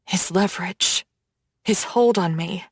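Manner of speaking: usual speaking voice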